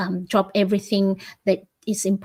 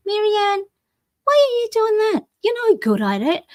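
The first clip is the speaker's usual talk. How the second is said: high, sneering inner critic voice; mocking inner critic; 12 semitones higher than usual. Life coach voicing the viewer's inner critic, high and sneering. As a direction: play the nagging negative Nellie.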